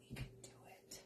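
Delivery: very quiet whisper to self; hushed, voiceless self-encouragement. Real, not acted. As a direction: whisper it like a private pep talk.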